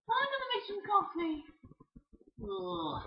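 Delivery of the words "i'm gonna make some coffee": high princess voice. Acted. Peach voice again.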